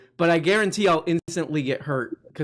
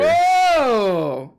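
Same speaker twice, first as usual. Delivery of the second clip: loud, much higher exclamation; surprised; 11 semitones higher, 8 dB louder than usual. Real, not acted. Host learning the guest runs the game, loud whoa. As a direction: gasp out the whoa in amazement. Guest reveals he's dungeon master.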